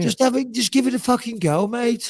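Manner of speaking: high-pitched Brummie coaxing; Birmingham accent. Shown as a demonstration. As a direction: egg a friend on in Brummie. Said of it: "getting you doing a Birmingham accent".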